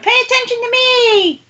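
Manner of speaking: mock child's yell, very high-pitched; imitated demanding yell. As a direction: squeal it out like someone demanding attention. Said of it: "I don't know why you're yelling".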